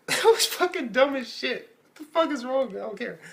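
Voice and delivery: very high, laughing, incredulous; real laughter. Real, not acted. Telling viewers the scene was dumb, laughing high. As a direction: crack up at how dumb it was.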